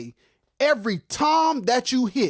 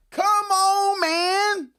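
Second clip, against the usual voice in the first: much higher shout, held out; fired-up outcry; 12 semitones higher, much slower than usual. Real, not acted. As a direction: cry it out in hyped disbelief. Exasperated outcry.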